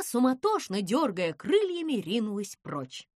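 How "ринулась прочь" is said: usual speaking voice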